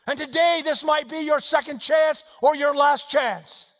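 loud, high, driving preacher delivery; full-force preaching. Acted. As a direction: preach it at a shout, urgent and relentless.

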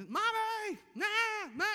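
very high mock child's holler; imitated toddler yelling. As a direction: yell for Mommy like a toddler waking up. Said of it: "she just starts yelling".

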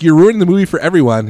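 loud, high, quick complaint; mock exasperated. Dude in the theater to the noisy dad, imitated exasperated complaint. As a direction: scold him as a fed-up moviegoer would. Heckler arguing with dad, retold.

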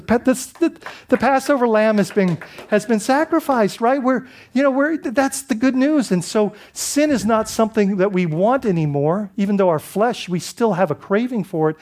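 ordinary talk, nothing marked

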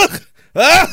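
high, put-on disgusted groan; mock revulsion noise; disgusted ugh. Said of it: "I go".

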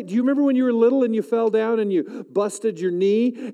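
usual speaking voice

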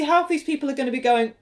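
ordinary talk, nothing marked

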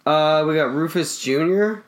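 usual speaking voice

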